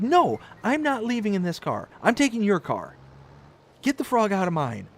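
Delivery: spiced-up imitation of an adamant wife; demanding wife. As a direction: lay down the law, no argument. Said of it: "I'm making her sound like she's, like, this crazy person".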